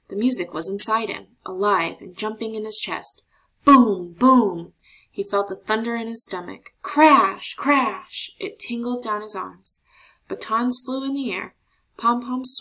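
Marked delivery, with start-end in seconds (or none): 3.6-4.7 loud drum booms; booming drum. A narrator's reading. Narrator imitating the marching band's drum, loud booms. 6.8-8.3 loud crashing cymbal sounds. A narrator's reading. Narrator imitating the band's cymbals, loud crashes.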